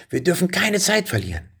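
high, pressing cry; urgent shout. A narrator's reading. Boy urging the dragon not to lose time, urgent high cry. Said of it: "schrie Benjamin".

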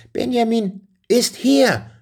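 put-on high, drawn-out cry of a boy; boy's shout. A narrator's reading. Benjamin announcing himself to his parents, shouting high. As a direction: call it out high and slow. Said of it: "schrie Benjamin".

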